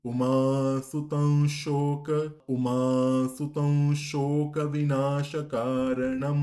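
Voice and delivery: সুতং with the special nasal before শোক, slow and loud; special anusvara. Shown as a demonstration. Teacher showing special anusvara in সুতং before শোক, slow. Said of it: "আরেকবার".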